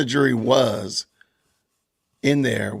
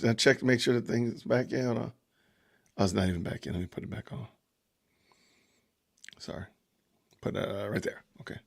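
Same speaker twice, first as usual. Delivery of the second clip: away from the mic; 3 semitones lower, 10 dB quieter, faster than usual. Real, not acted. Fixing the mic, off mic.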